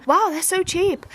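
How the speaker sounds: high, slow amazed wow; surprised. Retold or imitated. Telling the shop worker, high and slow amazement. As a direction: marvel at the bargain.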